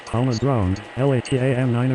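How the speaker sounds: pilot over the radio. Acted. Pilot radio call in sim.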